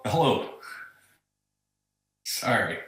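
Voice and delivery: speakerphone sound; distant speakerphone voice. Real, not acted. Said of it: "It sounds like you're using speakerphone".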